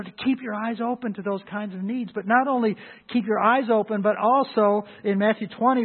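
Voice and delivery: ordinary talk, nothing marked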